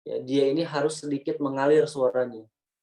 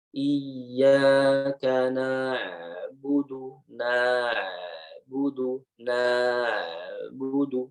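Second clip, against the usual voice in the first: "na'budu" drawn out very slowly, ain flowing; slow version; much slower than usual. Shown as a demonstration. Teacher shows the slow reading, very slow. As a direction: stretch every sound of "na'budu" slowly. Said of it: "Kalau lambatnya".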